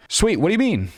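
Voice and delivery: mock-excited, high-pitched reply; hammed-up high excitement. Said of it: "Very excited".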